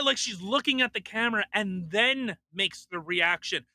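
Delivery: usual speaking voice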